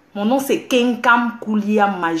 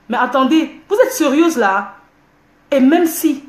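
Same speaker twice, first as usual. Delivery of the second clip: loud, raised, pitch up; indignant outburst; 4 semitones higher, 5 dB louder than usual. Real, not acted. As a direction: fire it back at them indignantly. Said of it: "je parle doucement". (éclat indigné, plus fort)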